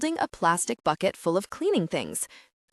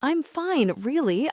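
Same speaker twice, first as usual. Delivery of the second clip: higher-pitched, hurried reassurance; 7 semitones higher than usual. A narrator's reading. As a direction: get the reassurance out quickly. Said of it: "I say, hurrieded".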